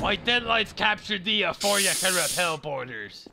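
lightly voiced pirate, farmer-ish West Country. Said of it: "He's a pirate!".

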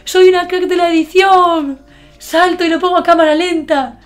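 mock-awed high, loud gushing; excited past self. Vlogger mimicking her younger self proud of her editing, excited. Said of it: "cuando hice el vídeo fue como".

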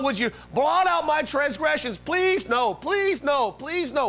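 high, hammed-up pleading; acted desperate plea; begging God. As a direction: beg over and over, pitch soaring on please. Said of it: "he's begging God".

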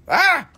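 high shrieked ah; excited squeal. Real, not acted. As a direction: squeal with delight. Excited at price.

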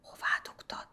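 hushed whispered question. A narrator's reading. Priest whispering to the boy in the church, whisper. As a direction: whisper so the soldier won't hear. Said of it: "suttogta a pap".

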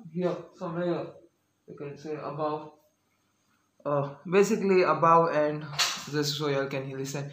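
muffled by cloth mask; speaking behind respirator mask; through a face mask. Real, not acted. Seen 1: Mask covers mouth mostly; pulled to chin about 5.5–11.7s.

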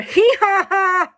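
a light donkey bray; donkey laugh. Acted. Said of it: "That was a donkey".

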